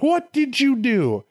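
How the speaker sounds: high, drawn-out mock accusation; accusing God. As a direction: stretch it out in scandalized disbelief. Acted God confronting Eve and Adam.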